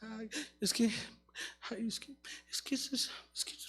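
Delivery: very soft, low, stammered excuse; mock whimpering. Preacher mimicking people stuck in the past, mock feeble whimper. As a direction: trail helplessly through a near-whispered whine.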